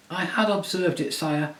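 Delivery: high, courtly little mouse voice; a tiny knight pleading with honour; proud mouse knight. Acted. Reepicheep speaking.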